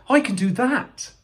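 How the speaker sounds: high, eager boast; eager high-voiced cry; excited cry. A narrator's reading. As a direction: pitch it right up with keen confidence. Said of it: "cried Mo".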